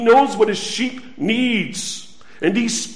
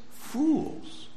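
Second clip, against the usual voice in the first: hushed fools; 6 semitones higher, 11 dB quieter than usual. Acted. Preacher voicing Jesus softly.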